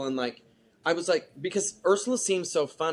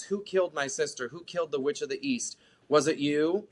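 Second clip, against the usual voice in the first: ordinary talk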